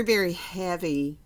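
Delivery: plain reading voice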